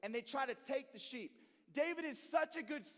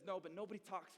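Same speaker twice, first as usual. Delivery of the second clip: firm, quiet resolve; 6 semitones lower, 6 dB quieter than usual. Retold or imitated. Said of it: "David says".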